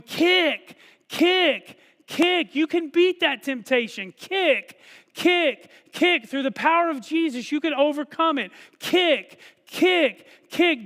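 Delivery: high, loud cheers of kick; heavenly cheering. Retold or imitated. Witnesses in heaven cheering believers on, shouting. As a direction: cheer the congregation on like fans at a race. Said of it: "cheering us on, going".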